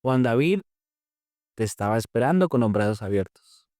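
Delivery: low, measured divine voice; hushed-down, solemn retold voice of God; voice at the ear. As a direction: speak low, as if close beside someone's ear. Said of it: "la voz de algo que me dijo al lado en mi oído".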